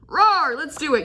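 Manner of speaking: a lightly hammed-up hype line; bold eager body voice. The eager fire body rallying, mock roar. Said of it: "your five D body's like".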